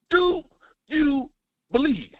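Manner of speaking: spaced out like a preacher's call, word by word; dramatic question. Acted. Caller rallying the host with a drawn-out dramatic question.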